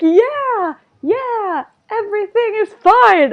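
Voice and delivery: high, drawn-out mock cheering; goofy cheer. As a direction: cheer it high like a silly dance chant. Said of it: "very goofy".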